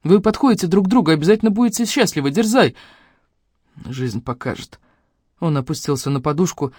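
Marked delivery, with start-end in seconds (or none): 0.0-2.8 quick, higher-pitched encouragement; encouraging. A narrator's reading. Sister encouraging brother about Katya, eager. 3.8-4.8 much quieter, resigned reply; defeated. A narrator's reading. Brother sinking onto pillow, defeated. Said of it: "выглядел разбитым".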